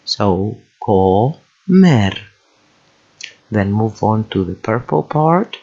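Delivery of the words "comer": Comer split into CO, pause, MER; syllable by syllable. Shown as a demonstration. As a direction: sound out Comer with the consonants pulled apart. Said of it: "separate the consonants to break down".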